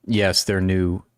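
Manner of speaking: usual speaking voice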